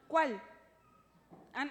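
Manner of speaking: ordinary talk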